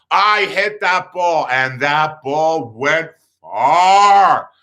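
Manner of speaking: very slow, drawn-out emphasis; triumphant reveal. Real, not acted. Storyteller revealing he actually hit it, triumphant. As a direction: stretch each key word out proudly.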